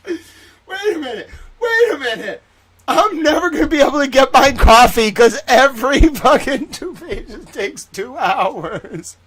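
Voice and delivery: high, breaking into laughter; laughing. Real, not acted. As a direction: laugh through the words, unable to stop. Laughing at own delay.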